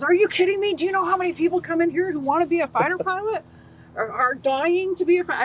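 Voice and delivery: high, loud, incredulous outburst; imitating an exasperated recruiter, high and loud; mock furious recruiter, fast and high; recruiter losing it. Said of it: "My recruiter lost his mind".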